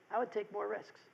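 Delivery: understated, hushed comeback; quiet deadpan reply. Retold or imitated. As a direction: let it drop quietly, unfazed. Brother's unfazed reply after the outburst.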